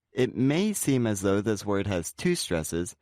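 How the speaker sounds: normal talking voice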